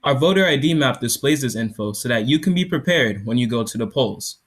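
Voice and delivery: loud, projected product demo; startup pitch. Acted. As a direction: sell it to the judges.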